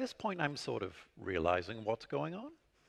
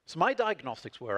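ordinary talk, nothing marked

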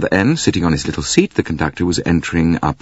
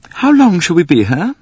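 demanding; 12 semitones higher, 4 dB louder than usual. Acted. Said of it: "demanded Mary Debenham".

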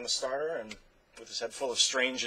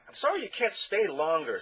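sadly; 8 semitones higher than usual. Acted. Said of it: "said Alex sadly".

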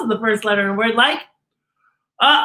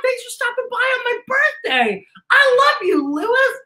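much higher, excited thanks; gushing; 12 semitones higher than usual. Real, not acted. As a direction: gush over a beloved character. Gushing to character toy.

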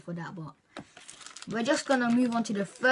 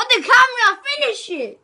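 shouting; 13 semitones higher, 12 dB louder than usual. Real, not acted. Egging friend on to eat.

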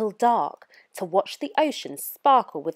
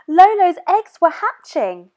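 high and loud, eager; excited; 9 semitones higher, 7 dB louder than usual. A narrator's reading. Moana excitedly shows grandma the hatching nest. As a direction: call grandma over, thrilled. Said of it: "she said excitedly".

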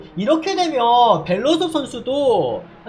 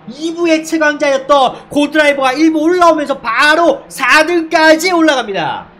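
excited call; 3 semitones higher, 8 dB louder than usual. Real, not acted. Live play-by-play overtake call.

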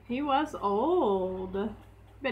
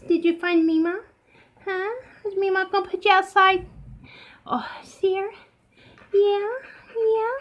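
high coaxing coo; pet talk; 8 semitones higher, 4 dB louder than usual. Real, not acted. Owner cooing higher at her dog about Mima.

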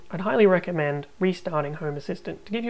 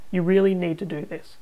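normal talking voice

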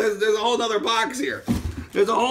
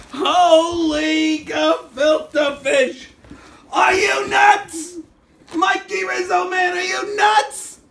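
loud, high, drawn-out disbelief; excited shout; 9 semitones higher, 6 dB louder, slower than usual. Real, not acted. Shouting at Mikey Rizzo for the generous gift, stunned. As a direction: shout at the friend in amazement.

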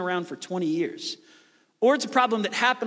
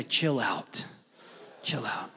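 1 softly calming; 7 semitones lower, 7 dB quieter, slower than usual. Shown as a demonstration. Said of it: "Turn to the person next to you and say".

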